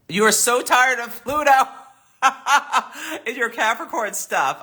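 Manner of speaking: high, laughing through the words; amused, laughing. Real, not acted. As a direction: burst into a real laugh mid-sentence. Amused by a viewer's chat comment.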